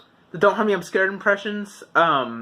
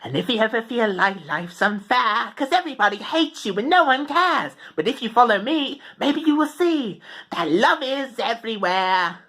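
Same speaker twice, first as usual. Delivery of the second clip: upbeat, more male character voice, raised pitch; Shrignolt; 4 semitones higher than usual. Shown as a demonstration. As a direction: lilt it like a singsong verse. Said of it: "like Paige, but do it in, like, a more male voice".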